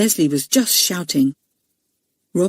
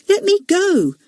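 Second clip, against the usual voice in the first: high, lightly voiced small boy; a frightened little boy asks to be let go; small boy pleading; 12 semitones higher than usual. Leslie to the bully, high small-boy voice. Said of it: "said Leslie".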